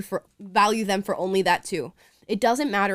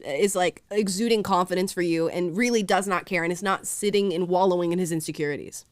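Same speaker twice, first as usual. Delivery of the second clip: usual speaking voice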